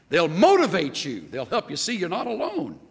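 usual speaking voice

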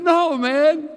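high, loud mock protest; retold emphatic protest. Friend rejecting the Marine's worry, retold as an emphatic protest.

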